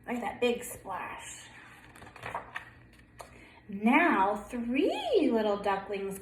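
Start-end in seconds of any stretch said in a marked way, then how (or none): none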